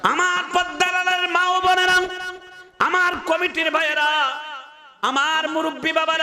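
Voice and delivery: high, ringing intoned address; preaching at full force. Real, not acted. Preacher addresses mothers, committee brothers and elders, high full-force chant. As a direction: hail the crowd on one high held note. Preacher hails groups in the crowd.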